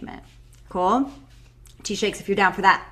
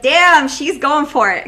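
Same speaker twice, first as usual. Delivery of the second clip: impressed surprise; 6 semitones higher, 9 dB louder, slower than usual. Retold or imitated. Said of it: "you're like".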